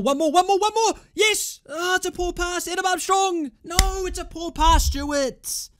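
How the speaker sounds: high, loud live-match yelling; frantic urging. Real, not acted. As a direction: urge them on, then wail at the pass. Urging players on during attack.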